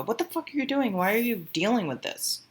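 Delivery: high-pitched exasperated mock scolding; acted incredulous scolding. Someone grabbing and shaking you, acted incredulous scolding. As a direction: grab and shake them with the question. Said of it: "someone to grab you and shake you and go".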